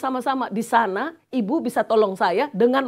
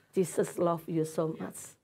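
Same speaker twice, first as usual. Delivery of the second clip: tender; 3 semitones lower, 7 dB quieter, slower than usual. Shown as a demonstration. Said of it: "Embrace her dan bilang gini".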